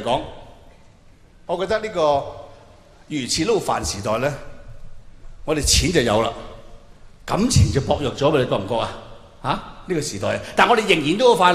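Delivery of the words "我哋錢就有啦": normal talking voice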